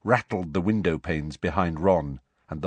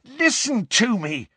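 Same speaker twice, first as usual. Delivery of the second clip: higher and slower, with an edge of anger; almost angry; slower than usual. A narrator's reading. Harry stopping his smirking friends, almost angrily. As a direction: cut them off, half angry. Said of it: "said Harry, almost angrily".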